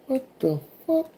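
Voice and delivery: very high, hushed squeak; mock-stunned, small high voice; stunned disbelief. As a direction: act out quiet, squeaky bewilderment. His stunned reaction, retold.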